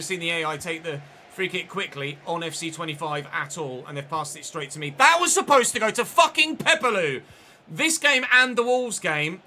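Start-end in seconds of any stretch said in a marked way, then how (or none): 5.0-9.4 loud, higher, slowing indignant outburst; angry rant. Real, not acted. Gamer ranting that the pass should have gone to Pepelu, loud and angry. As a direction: rage at the game, spelling it out. The AI passed to him.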